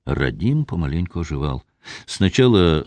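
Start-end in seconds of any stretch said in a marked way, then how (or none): none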